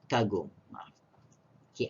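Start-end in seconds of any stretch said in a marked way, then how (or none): none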